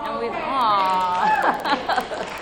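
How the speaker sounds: ordinary talk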